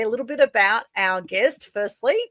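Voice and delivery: usual speaking voice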